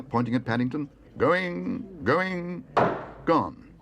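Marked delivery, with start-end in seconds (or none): 1.2-3.7 auctioneer's going gone. Acted. Auctioneer closes the sale on the bear. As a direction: bring the hammer down with your voice.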